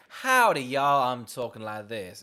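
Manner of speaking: mocking an actress's fake American twang; mock twang accent. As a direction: ham up a cowboy twang to mock it. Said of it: "a twang accent".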